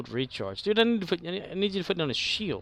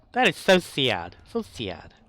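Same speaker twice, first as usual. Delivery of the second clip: louder mock lament; mock-sad outburst at the game; 7 dB louder than usual. Real, not acted. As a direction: belt out the disappointment. Reacting loudly to game.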